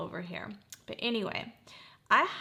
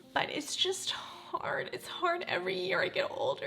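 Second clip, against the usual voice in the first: high, choked, whispery grief; tearful and strained, slipping toward a whisper; holding back crying; 7 semitones higher than usual. Real, not acted. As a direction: let the voice catch on real tears. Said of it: "I don't wanna keep crying".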